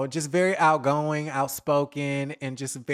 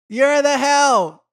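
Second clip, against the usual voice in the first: loud, slow, higher put-down; punchline put-down; 9 semitones higher, 7 dB louder, slower than usual. Retold or imitated. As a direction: drag it out and land it hard. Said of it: "And it's just like".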